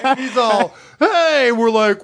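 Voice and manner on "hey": high, strained indignant "hey"; mock angry yell. Storyteller as the pissed man confronting teens, high mock yell. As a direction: call out high like an angry victim. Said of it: "he was pissed".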